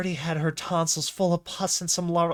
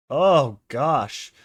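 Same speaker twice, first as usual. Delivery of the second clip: startled gasp; 8 dB louder than usual. Real, not acted. Reacting to gross game scene.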